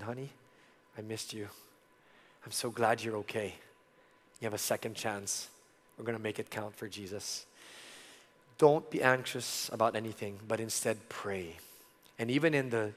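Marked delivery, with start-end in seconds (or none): 0.0-7.4 tender to wife. Retold or imitated. Tender words to wife after surgery.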